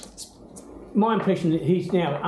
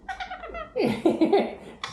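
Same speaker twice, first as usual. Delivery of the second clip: high giggle. Real, not acted. Laughs while tickling parrot.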